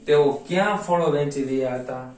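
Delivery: ordinary talk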